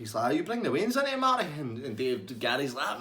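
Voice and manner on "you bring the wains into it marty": outraged high. Retold or imitated. Gary outraged at barman.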